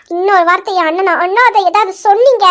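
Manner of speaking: ordinary delivery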